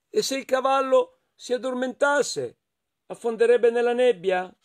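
high-pitched little character voice; wondering hedgehog. Acted. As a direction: muse it in a high, puzzled voice. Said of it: "pensò Riccio". (vocina acuta e perplessa del riccio)

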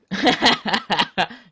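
loud taunting cackle; mocking laugh. Real, not acted. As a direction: laugh right in their face. Rire moqueur. (rire moqueur bruyant)

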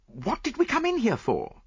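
high-pitched, impatient question; impatient demand. A narrator's reading. Friend questions the brooding detective, high and impatient. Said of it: "I demanded impatiently".

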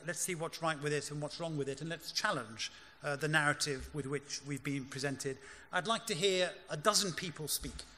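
usual speaking voice

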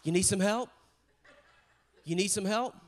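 loud, chirpy offer of help; playful loud offer, lifting at the end; chipper helper. Retold or imitated. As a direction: call out brightly to a struggling rider. God as cheerful cyclist offering help.

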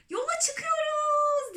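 very high, slow, drawn-out cry; chorus-like shout. A narrator's reading. The others echoing the centipede, long high cheer. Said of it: "diye bağırdı öbürleri de".

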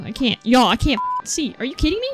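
ordinary talk

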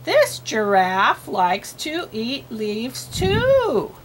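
very slow, word by word, lifting on the last; slow read-aloud. Shown as a demonstration. Models reading for a learner.

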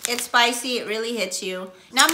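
biting into crunchy chip; talking while eating a chip. Real, not acted. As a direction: mouth partly full, chewing. Seen 2: Picks a chip, bites it mid-speech at 2.4-2.9, chewing after.

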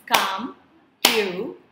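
"computer" slowly split as com, pu, ter; three separate beats on "computer"; syllable by syllable. Shown as a demonstration. Teacher showing a three-syllable word, "computer" split. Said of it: "divided into three chunks".

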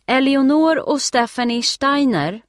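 loud, high call asking the room; lady calling the sisters' names, loud. A narrator's reading. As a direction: call out so everyone hears. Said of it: "upprepar damen med hög röst".

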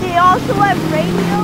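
excited. Real, not acted. Excited discovery of radio.

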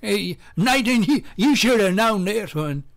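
mock teasing. Mock quizmaster calling out Nathan.

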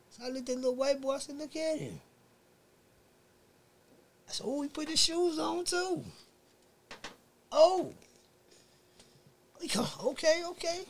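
high, quick, hushed telling; tense excitement. Retold or imitated. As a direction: narrate as a fight is about to start. Retelling tense domino scene, high voice.

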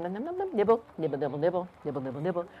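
soft, low munching patter; quiet nibbling. A narrator's reading. Storyteller voicing a rabbit munching in the jungle, quiet nibbling. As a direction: nibble away under your breath.

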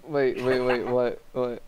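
loud startled wait; alarmed shout. Real, not acted. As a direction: shout in surprise.

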